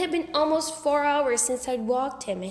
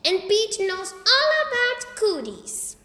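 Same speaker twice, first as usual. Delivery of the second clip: put-on higher, slow, sing-song teasing kid; gleeful tease; 10 semitones higher, 5 dB louder than usual. A narrator's reading. Fudge teasing Pete in front of Buzzy, high sing-song. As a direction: needle big brother gleefully.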